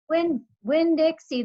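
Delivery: high, loud shouting call; shouted. A narrator's reading. Preacher shouting for the dog in the rain. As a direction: shout the dog's name. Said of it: "the preacher shouted".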